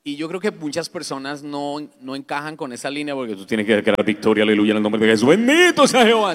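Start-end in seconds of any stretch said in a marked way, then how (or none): 3.5-5.3 loud, low, rapid mock declaration; churchgoers declaring victory. Pastor imitating people who declare victory, loud and rote. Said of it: "dice la gente". 5.3-6.3 high, loud mock praise shout; churchgoer's praise. Pastor imitating people who declare victory, high and loud. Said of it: "dice la gente".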